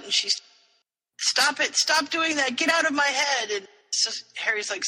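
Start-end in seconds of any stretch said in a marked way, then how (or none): 1.2-3.5 higher-pitched retold panic; mock freak-out. River telling Harry to get out, mock freak-out. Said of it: "River freaks out".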